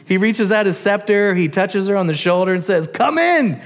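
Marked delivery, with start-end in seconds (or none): 2.9-3.6 high, welcoming king voice; lively royal welcome; eager king. Acted. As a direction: call her in warmly like a pleased king. King invites her again.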